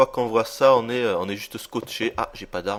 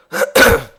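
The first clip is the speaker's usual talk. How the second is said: harsh cough; 11 dB louder than usual. Real, not acted. Sick player coughing.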